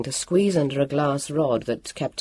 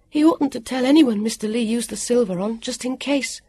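lightly voiced higher character, cautioning; mild higher-pitched warning; 9 semitones higher than usual. A narrator's reading. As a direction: warn in a raised pitch. Vivian warns, worried.

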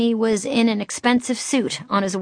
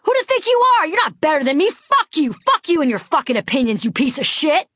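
high, self-righteous shouted rant; raging tirade; 8 semitones higher, 4 dB louder than usual. A narrator's reading. Woman chasing rich passenger as he flees, raging tirade. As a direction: hurl each insult in a rage haze. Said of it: "I was in a rage haze".

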